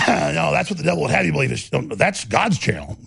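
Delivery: normal talking voice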